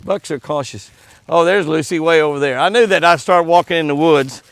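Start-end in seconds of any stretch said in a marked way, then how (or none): none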